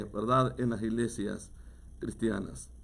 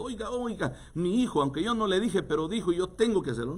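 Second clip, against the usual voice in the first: higher, hurried mock God; mock flustered God; 6 semitones higher than usual. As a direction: play God scrambling to obey. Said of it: "piensan que Dios va a salir corriendo". (Dios apurado en tono burlón, más agudo)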